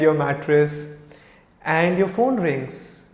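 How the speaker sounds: ordinary delivery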